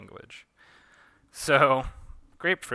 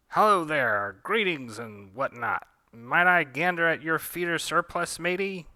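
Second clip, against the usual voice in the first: louder, higher hammed-up pirate; mock pirate; 6 semitones higher, 7 dB louder than usual. Darla pretending to be a pirate to the shopkeeper, hammy. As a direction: ham it up as a fake pirate. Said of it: "Darla's basically pretending to be a pirate".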